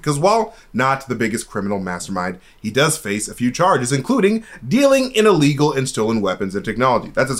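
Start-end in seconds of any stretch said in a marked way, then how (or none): none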